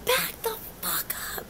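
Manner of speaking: high, hushed stand-in for a yell; mock yell. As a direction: yell it under your breath, high-pitched. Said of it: "I'm yelling, cursing at the people".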